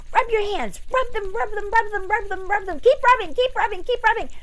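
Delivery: excited coaching. Real, not acted. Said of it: "if you rub your hands".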